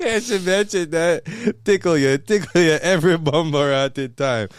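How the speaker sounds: high, loud patois outburst; exuberant, hammed-up patois; hammed-up Jamaican patois. Host joking with fiancee, high loud patois. Hamming up patois after laughing.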